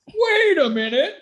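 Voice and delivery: mock shock at something trivial; mock amazed viewer. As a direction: gasp at it like a naive viewer. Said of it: "supposed to watch it home and be like".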